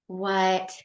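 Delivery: disbelieving what. Retold or imitated. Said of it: "I'm like".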